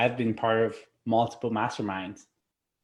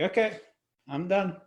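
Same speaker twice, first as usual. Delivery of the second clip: higher, lightly voiced plant giving up; 7 semitones higher than usual. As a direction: play the plant calmly calling it quits. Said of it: "a plant ... it's like".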